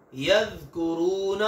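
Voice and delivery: यज़कुरून with a thin, soft dhal and no whistle, drawn out; Arabic dhal, thin and soft. Shown as a demonstration. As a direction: say यज़कुरून with a light unwhistled dhal. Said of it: "बारीक और नरम होती है".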